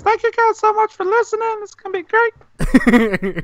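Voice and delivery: high, far-off mock host voice, slowing at the end; mock announcer voice. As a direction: ham up a cheesy podcast sign-off, way up high. Mock podcast sign-off voice.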